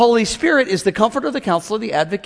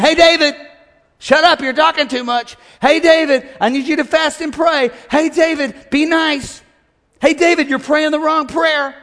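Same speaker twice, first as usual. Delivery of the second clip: higher, firm calling out to David; voice of God; 6 semitones higher than usual. Retold or imitated. God correcting the preacher David. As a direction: call David to order. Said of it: "God is speaking, saying".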